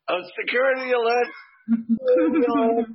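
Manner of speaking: high, fast mock alarm announcement; alarm call. Retelling the airport alarm going off, mock announcement.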